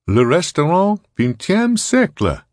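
high, loud cabaret announcer voice; showy emcee introduction, high and projected; disembodied announcer. A narrator's reading. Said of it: "said a disembodied voice with a pronounced Sprawl accent".

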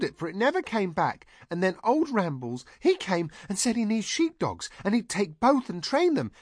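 high-pitched, artless rattling on; breathless run-on tale in a very high voice; excitable Heather voice. A narrator's reading. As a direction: chatter it out high, like an excitable simple girl. Heather excitedly explains about puppies.

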